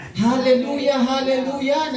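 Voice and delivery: mock joyful cry; baby hallelujah. Pastor voicing the baby's praise, joyful. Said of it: "Nagsa-hallelujah yung baby".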